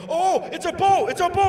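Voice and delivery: mock excited discovery. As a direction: play the fake eureka big, stretched out. Mock magic eye realization.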